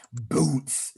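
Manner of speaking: Boots with an explosive B like a kick drum; explosive bass drum. Shown as a demonstration. Coach demos the beatbox bass drum, explosive Boots. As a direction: pop the B in Boots hard. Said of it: "It's gonna be very explosive".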